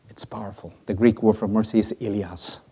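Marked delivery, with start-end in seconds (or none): 0.1-0.7 whispered in awe; awed whisper. Real, not acted. Preacher marvelling at the word mercy, whispered. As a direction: breathe it out softly.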